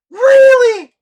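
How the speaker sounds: high, loud outraged "Really?"; indignant disbelief. Retold or imitated. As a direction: fling it out in disbelief. Indignant at being sent first.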